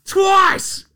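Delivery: thrilled shout; excited shout. Real, not acted. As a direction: yell it out in glee. Excited about dunks.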